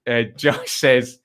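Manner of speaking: ordinary talk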